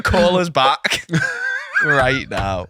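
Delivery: bossy caller breaking into laughter; laughing official caller. Pretend. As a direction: demand it, cracking up. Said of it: "Start sending him fake letters".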